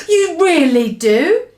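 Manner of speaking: light up with hope; brightening. Acted. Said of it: "cried the B F G suddenly brightening".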